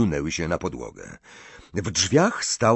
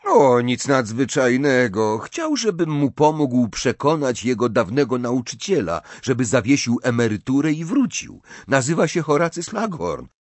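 higher, animated character voice; Harry, casual explaining; 5 semitones higher, 4 dB louder than usual. A narrator's reading. Harry explains to his friends about a teacher, casual. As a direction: play a boy lightly explaining his errand.

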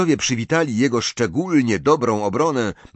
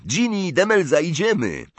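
high, eager summons; keen, pitched-up call to come along; excited call; 8 semitones higher than usual. A narrator's reading. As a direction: round up the friends eagerly. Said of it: "zawołał podniecony Dean".

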